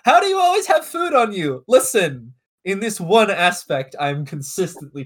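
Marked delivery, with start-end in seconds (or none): none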